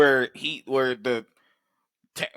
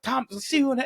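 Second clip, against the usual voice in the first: put-on kid voice; 12 semitones higher than usual. Kids taunting grabber.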